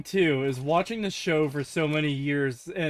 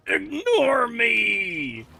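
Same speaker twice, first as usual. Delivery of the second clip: slow, drawn-out, higher cry of "Ignore me!"; Galactus parody catchphrase; 7 semitones higher, much slower than usual. Retold or imitated. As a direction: stretch it out like a pleading cosmic giant.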